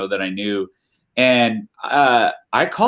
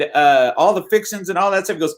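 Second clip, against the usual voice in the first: usual speaking voice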